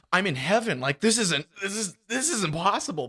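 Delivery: can't believe the windfall; acted amazement. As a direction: act out giddy astonishment. Acting out delight at being overpaid.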